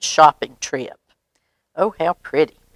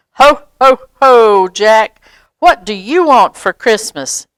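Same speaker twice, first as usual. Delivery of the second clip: much louder, higher ho ho ho; booming Santa; 4 semitones higher, 8 dB louder than usual. Acted. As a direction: boom it out like a proud Santa. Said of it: "Watch this".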